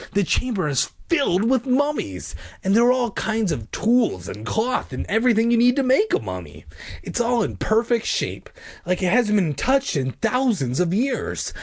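high-pitched, eager description; excited boy, voice riding high; unhidden excitement. A narrator's reading. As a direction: gush about the discovery. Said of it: "I couldn't hide my excitement".

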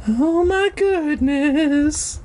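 very high, slow drawn-out wail; mock dismay. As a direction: draw it out high in mock shock. Host's mock wail after the cliffhanger.

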